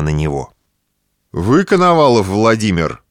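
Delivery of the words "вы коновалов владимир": booming metallic robot; cold machine-like question. A narrator's reading. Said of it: "металлическим голосом спросил".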